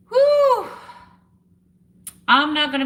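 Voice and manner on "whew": a high breathy release of feeling; a real, tearful whew; shaky emotional whew. Real, not acted. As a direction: let the emotion out on an exhale. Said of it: "I don't know what is going on with me emotionally".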